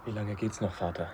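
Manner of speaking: hushed and uneasy. Acted. Frightened son on the mountain walk.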